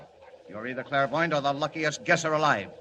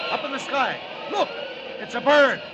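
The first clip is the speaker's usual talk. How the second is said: high, loud, astonished cries; excited shouting; 11 semitones higher, 3 dB louder than usual. Acted. Onlookers spot Superman in the sky and shout excitedly. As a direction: point up and shout in wonder.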